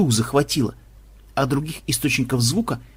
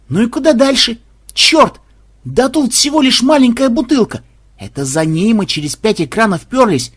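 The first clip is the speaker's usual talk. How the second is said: frustrated; 6 semitones higher, 4 dB louder than usual. Acted. Gamer frustrated at screen.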